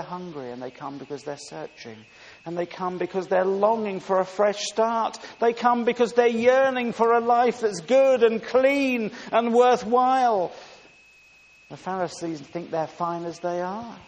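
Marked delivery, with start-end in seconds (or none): 2.4-10.5 building louder and higher to a ringing peak; fervent preaching. Acted. Preacher telling of sinners yearning for a fresh start, fervent. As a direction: let the repeated phrases climb.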